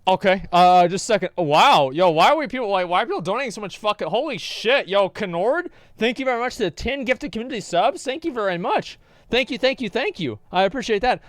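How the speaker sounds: loud, high, amazed gratitude; excited thanks. Real, not acted. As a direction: gush thanks at surprise donations. Surprise at a flood of donations.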